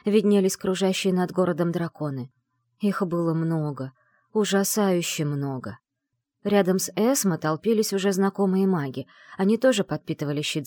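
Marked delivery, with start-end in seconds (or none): none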